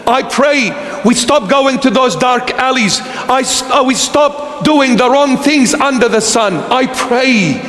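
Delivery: low, steady litany of I pray, fading at the end; preaching prayer. Acted. Pastor praying over his beloved congregation, low and fading.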